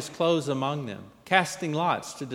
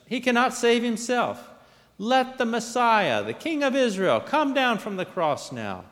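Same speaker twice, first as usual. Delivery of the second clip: high-pitched scornful taunt; raised, derisive proclamation; mocking; 10 semitones higher, 4 dB louder than usual. A narrator's reading. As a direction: scoff at his claims, voice pitched up. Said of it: "mocking him among themselves".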